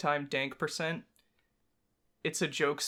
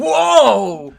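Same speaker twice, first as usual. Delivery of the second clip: loud high whoa; startled cry out; surprised shout; 7 semitones higher, 14 dB louder than usual. Real, not acted. Startled exclamation.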